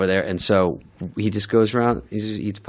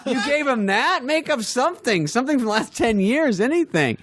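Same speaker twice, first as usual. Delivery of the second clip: very high, incredulous telling-off; exasperated mock scolding. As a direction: scold a hapless friend in comic disbelief. Retold scolding of Farley after meeting.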